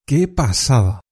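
amazed, falling exclamation; teacher showing delight; enthusiastic. Shown as a demonstration. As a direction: gush with delight. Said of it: "otra forma de decir que algo te gusta mucho".

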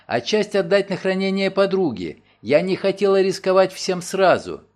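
a worried woman rushing her story; Nancy anxiously explaining to her lawyer; anxious young woman. Acted. Nancy's figure voice.

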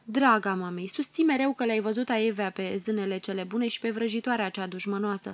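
ordinary delivery

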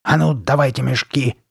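stern, commanding monster voice; cold order. A narrator's reading. Masked girl demanding the boys' bags, cold order. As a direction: issue the demand coldly and firmly. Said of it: "холодно приказала Карли Бет".

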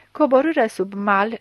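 ordinary delivery, nothing marked

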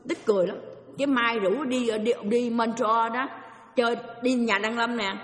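normal talking voice

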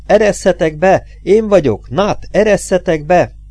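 high, loud pleading cry at the door; desperate shout. A narrator's reading. Bleeding man hammering on his own door, frantic shout. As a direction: yell to be let in, frantic. Pounding on door, begging entry.

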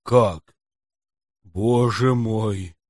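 slow stunned gasp of shock; as if hit in the heart; clutching his heart. Acted. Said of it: "схватился за сердце".

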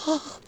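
high breathy languid sigh. A narrator's reading. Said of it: "томно выдохнула девица".

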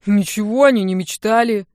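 loud mumble through food; mouth full. A narrator's reading. Brother answers uncle while eating, mouth full. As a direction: talk round a big mouthful. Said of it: "с полным ртом пробубнил брат".

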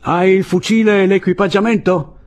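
high, loud sentry's call; shouted questioning. A narrator's reading. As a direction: call the question over a barricade.

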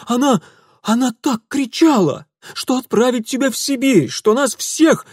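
high, loud frantic yelling; shrill shouting aunt. Acted. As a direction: scream it at him, outraged. Aunt screaming at Mitya.

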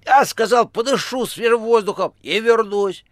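high, loud, effusive patter; chatterbox phone voice. A narrator's reading. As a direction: pour it out high and brisk. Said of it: "говорила трубка". (высокий, громкий, болтливый голос)

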